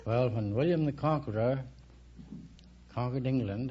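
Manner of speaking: normal talking voice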